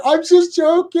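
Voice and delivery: high-pitched backpedalling; defensive protest. Retold or imitated. Retelling an excuse to the offended coworker, high defensive protest.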